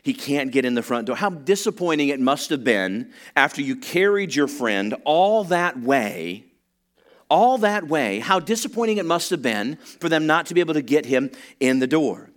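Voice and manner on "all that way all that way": second all that way higher and louder; emphatic repeat. Real, not acted. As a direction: drive home all that way. Preacher repeats phrase emphatically.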